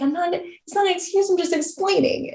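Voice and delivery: high-pitched defensive protest; retold protest. Answering people who call it excuses, acted high protest. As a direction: imitate pleading to be believed.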